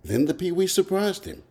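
ordinary delivery

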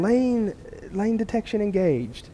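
deadpan machine voice; car voice. Retold or imitated. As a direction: read it like the dashboard talking. Voicing the car's display.

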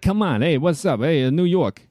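brash New York guy greeting the street; New York accent, hammed up a bit; New Yorker. Shown as a demonstration. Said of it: "maybe I'll do a New Yorker".

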